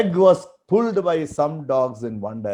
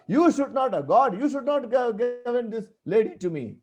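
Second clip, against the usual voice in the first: blaming God; 6 semitones higher than usual. Retold or imitated. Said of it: "He was hitting God".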